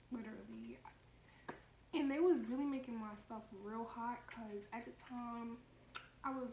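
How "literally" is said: breathy whisper, deadpan; whispered insistence. Retold or imitated. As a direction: whisper the word as a flat final denial. Insisting under her breath.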